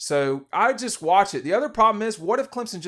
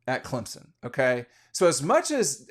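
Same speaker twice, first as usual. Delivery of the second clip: normal talking voice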